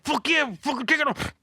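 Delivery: high, rowdy jeering at the fallen friend; mock excited yell. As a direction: egg him on like a rowdy crowd. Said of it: "we're like".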